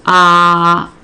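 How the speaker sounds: "ah" held long and loud as one isolated vowel; segmented sound. Shown as a demonstration. Teacher sounding out pop piece by piece, drawn out. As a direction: sound out "ah" alone, steady and stretched. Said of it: "finger spell each piece".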